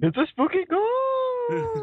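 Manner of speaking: high, drawn-out ghostly voice; eerie high tail, stretched out; mock spooky ghost. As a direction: play a silly wailing ghost. Joins in the spooky name gag.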